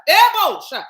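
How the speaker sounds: sudden high, loud burst; abrupt shouted interjection; loud spirit exclamation. Real, not acted. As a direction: blurt out the sacred syllables. Sudden spirit exclamation mid talk.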